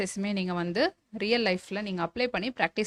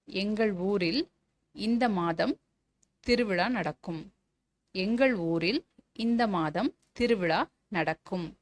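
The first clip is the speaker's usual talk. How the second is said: slow dictation; slower than usual. Shown as a demonstration. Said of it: "teacher dictates a model sentence".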